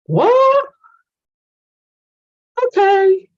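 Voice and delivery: sudden high, loud squeak of disbelief; high, loud, hammed-up double take; mock shock. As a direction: jump way up in pitch as if the idea is absurd. Mock shock at self-doubt.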